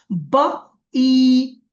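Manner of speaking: বা ই বি drawn out very slowly; slow phonics reading. Shown as a demonstration. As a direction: stretch বা ই বি, each sound held long. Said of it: "উচ্চারণ করেন আর বলেন". (বা ই বি খুব ধীরে টেনে টেনে)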